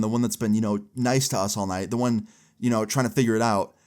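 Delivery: ordinary delivery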